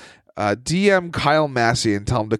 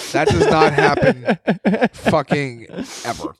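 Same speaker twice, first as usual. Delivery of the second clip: laughing outrage; 8 semitones higher, slower than usual. Real, not acted. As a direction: blurt it out laughing in disbelief. Outraged laughing rant about movie.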